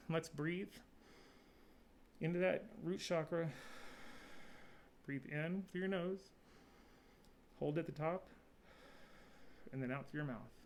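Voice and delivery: soft, unhurried meditation guide with long pauses; hushed breathing cues, spaced far apart; guided breathing meditation. Acted. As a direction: gently lead each breath, leaving space between phrases.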